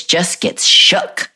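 slow, breathy "shook" drawn out; dragged out for effect. Real, not acted. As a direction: let "just gets shook" stretch slowly. Drawn-out emphasis.

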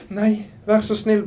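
high, loud, drawn-out plea; acted desperate begging; pleading. A narrator's reading. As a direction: beg loudly and slowly for mercy. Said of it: "ba hun".

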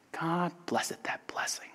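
near-whispered, reverent echo; hushed blessing. Acted. Preacher echoing the nephew's blessing of Christmas, hushed. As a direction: let the blessing drop to a whisper.

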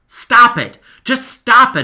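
loud, high yell. A narrator's reading. Boy yelling at two fighting friends, loud. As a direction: shout them apart. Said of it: "I yelled at both of them".

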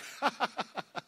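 high, staccato mock laugh; light high-pitched put-on laughter; imitated kid's laugh. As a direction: mimic a little boy with quick high giggly bursts. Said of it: "and he's like".

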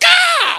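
sudden high loud yelp of pain. Retold or imitated. Dad stepping on a kid's toy, sharp yelp. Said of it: "I was like".

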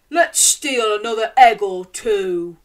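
loud, sly robber voice; Lanky Len scheming. A narrator's reading. Lanky Len tells Hefty Hugh his plan, loud and sly. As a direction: plot like a cartoon crook. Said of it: "Said Lanky Len to Hefty Hugh".

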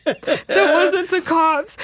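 high, drawn-out laughing exclamation; slow, high, helpless amusement; laughing incredulity. Real, not acted. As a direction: crack up while stretching the words. Cracking up at friend's mistake.

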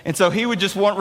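usual speaking voice

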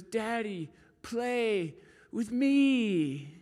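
put-on high, very slow little girl's plea; toddler voice. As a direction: ask like a two-year-old tugging at dad. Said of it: "she just goes".

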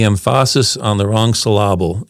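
"emphasis" as em-FAH-sis, "syllable" as sil-LAH-ble; misplaced syllable stress. Shown as a demonstration. Quoting an English teacher's joke, stress on wrong syllables. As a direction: shift the stress onto the second syllable of "emphasis" and "syllable". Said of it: "used to say this to make the point".

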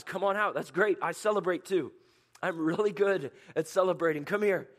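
high, loud joyful welcome; God celebrating with them. Acted. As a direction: cheer them out of hiding.

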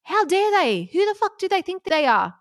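high-pitched, indignant rant; worked-up anger. Real, not acted. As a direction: get fired up on their behalf, pitch climbing. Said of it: "I get angry at them".

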